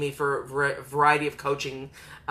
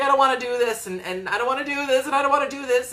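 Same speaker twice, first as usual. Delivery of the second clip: higher, hammed-up reluctant whine; whiny Frodo; 8 semitones higher, faster than usual. Frodo balking at entering the mountain, higher whine. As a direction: moan it like a hobbit who won't go. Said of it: "And he's like".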